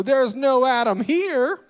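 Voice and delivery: put-on a kid proudly in character, stretching it out slow and high; slow, high little-cowboy swagger; Cowboy Clem voice. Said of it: "I would go".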